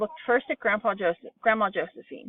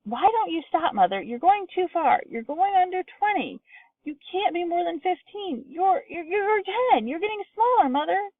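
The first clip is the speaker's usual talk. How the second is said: very high, panicked pleading; shrill alarm; 10 semitones higher than usual. A narrator's reading. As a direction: daughter begging her mother to stop. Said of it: "a shrill note of alarm in her voice".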